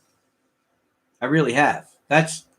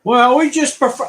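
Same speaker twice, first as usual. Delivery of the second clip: mimicking office staff; 9 semitones higher, 6 dB louder than usual.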